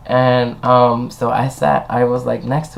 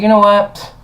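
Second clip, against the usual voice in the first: exasperated outburst; 8 semitones higher, 6 dB louder than usual. Retold or imitated. Fed-up retort.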